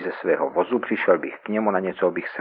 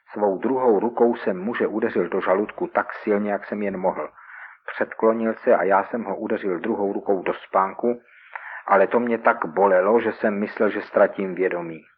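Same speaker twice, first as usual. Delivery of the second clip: ordinary delivery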